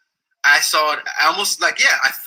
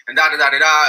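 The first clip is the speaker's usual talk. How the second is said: normal talking voice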